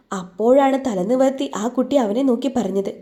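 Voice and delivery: ordinary delivery, nothing marked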